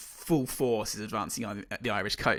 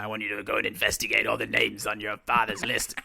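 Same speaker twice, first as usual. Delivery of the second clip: light gruff Irish impression of the commander; a mock gruff smoker's Irish, only lightly put on; imitating Judi Dench; 2 semitones lower than usual. Friend on a podcast quoting the commander's order, lightly imitated. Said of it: "at the end when she's like".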